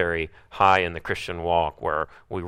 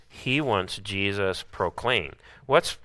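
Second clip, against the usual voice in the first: usual speaking voice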